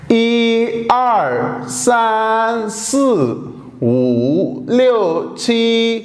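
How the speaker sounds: 一二三四 五六七 drawn out syllable by syllable; very slow counting. Shown as a demonstration. Teacher counting for students very slowly. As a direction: stretch each number very slowly. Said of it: "इन सभी को इकट्ठा पढ़ूं".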